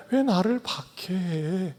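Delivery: a wounded, tender question; pleading. Acted. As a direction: ask it softly, hurt not angry. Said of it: "이렇게 호소하시는".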